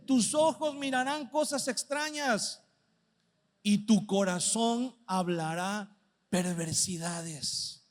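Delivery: versículo lento y grave, alargando cada palabra; lectura solemne. Acted. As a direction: proclama la escritura despacio y con peso. Lee el versículo con solemnidad. (predicador lee el proverbio al borracho, lento y solemne)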